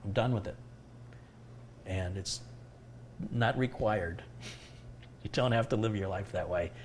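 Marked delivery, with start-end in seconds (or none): none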